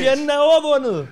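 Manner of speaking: loud, forceful echo; match the preacher's full force; triumphant preaching. Real, not acted. Interpreter relaying the victory to the congregation, loudly triumphant.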